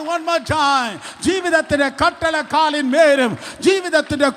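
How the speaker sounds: high, loud shouted proclamation; full-force preaching. Acted. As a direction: thunder the declaration over the church.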